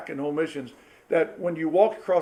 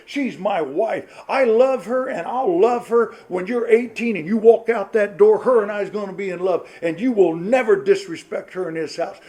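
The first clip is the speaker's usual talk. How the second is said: stern dad lecture; 3 semitones higher, 6 dB louder than usual. Retold or imitated. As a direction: lay down the law to the boys. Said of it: "I wanted them to think I was".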